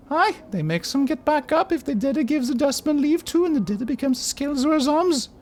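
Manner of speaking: put-on very high, small girlish voice; girl of ten voice. Player voicing hooded Pox in a high girlish voice. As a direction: squeak it out like a ten-year-old girl. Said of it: "I'm doing a silly voice".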